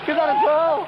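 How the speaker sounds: ordinary talk, nothing marked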